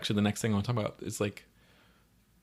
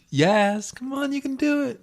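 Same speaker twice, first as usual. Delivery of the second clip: high-pitched, louder cheer in imitation; mock rallying shout, pitched way up; acted cheering on; 8 dB louder than usual. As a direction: cheer someone on in a silly high voice. Friend calls from car window.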